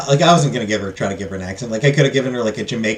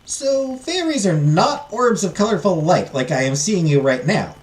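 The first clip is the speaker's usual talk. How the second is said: put-on higher, slow servant girl voice; tentative question in a raised voice; timid servant; 5 semitones higher, slower than usual. Servant overcoming fear to question the fairy, timid higher voice.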